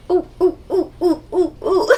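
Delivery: rising playful oohs; playful cooing. Real, not acted. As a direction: coo along with the buzz. Cooing at vibration.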